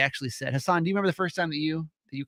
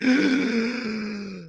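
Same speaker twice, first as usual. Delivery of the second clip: long, exaggerated mock gasp; appalled gasp; 5 semitones higher than usual. Parent friend hearing spanking suggested, hammed-up gasp. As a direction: suck in air in mock horror.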